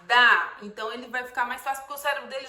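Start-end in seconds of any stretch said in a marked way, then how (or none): 0.1-0.6 "Bá" loud and drawn out; blended syllable. Shown as a demonstration. Teacher blending B and A for a child, loud. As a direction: punch "Bá" out clearly. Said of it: "O som do D e do A".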